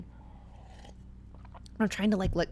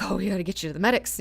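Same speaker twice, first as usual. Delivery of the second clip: rushed fretting; much faster than usual. Retold or imitated. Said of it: "he's like".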